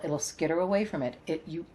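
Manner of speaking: usual speaking voice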